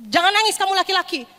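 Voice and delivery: high-pitched mock parental order; stern parent. Parent telling a bullied son not to cry, stern. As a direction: scold the tears away, pitched high. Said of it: "Biasanya gitu ya".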